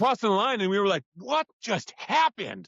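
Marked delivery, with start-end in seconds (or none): 1.2-2.7 high, slow, drawn-out amazement; acted-out bewilderment, pitched up; stunned disbelief. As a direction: stretch each word in retold astonishment. Stunned rowers after winning, retold.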